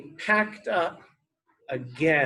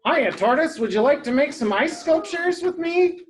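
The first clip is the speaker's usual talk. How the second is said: high, quick, louder invitation; eager rabbit; 10 semitones higher, 5 dB louder, faster than usual. Acted. Rabbit invites Tortoise to play, bright-eyed eager voice. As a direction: pitch it up and rattle it off eagerly.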